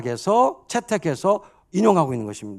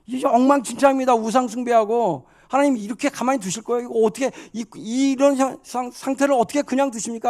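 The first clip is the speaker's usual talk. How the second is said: high, insistent complaint to God; acted prophet demanding answers, voice raised in pitch; challenging, arguing; 6 semitones higher than usual. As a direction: remonstrate urgently, pitched high. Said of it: "하박국이 먼저는 이제 따지죠".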